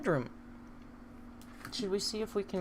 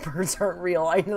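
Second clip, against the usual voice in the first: normal talking voice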